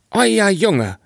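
high, distressed woman's cry; upset wife. Acted. Sexton's wife bursts in on the father, high distressed call.

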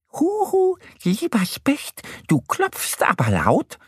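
high, squeaky little duck calling; high sing-out duck voice; duck character voice. A narrator's reading. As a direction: hail someone as a cheery cartoon duck. Duck Annie greets woodpecker.

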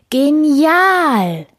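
high, loud, drawn-out cheer; excited crowd call. A narrator's reading. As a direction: call it out like a thrilled audience. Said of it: "riefen sie". (begeistert gerufen)

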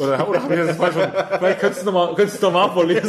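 teasing through laughter; amused mock request; laughing. Real, not acted. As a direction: laugh while asking him to read it again. Teasing the storyteller.

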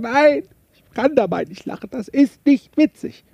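high-pitched mock protest, stretched out at the end; indignant objection. Objectors refusing to laugh at all, mock indignant. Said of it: "die sagen erstmal".

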